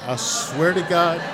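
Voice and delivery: normal talking voice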